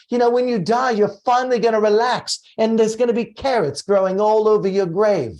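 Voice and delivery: lightly voiced mock scolding of uptight Americans; Stuart Wilde impression. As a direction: mimic a brash contrarian guru scolding the crowd. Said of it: "do the impression of when you went and took all your clients to Stuart Wilde".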